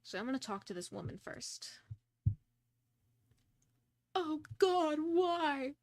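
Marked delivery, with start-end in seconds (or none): 4.1-5.8 higher, hammed-up lament; mock wailing. Grieving sister crying out to the detective, mock wail. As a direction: cry it out theatrically, mock-grieving. Acting a weeping woman in game.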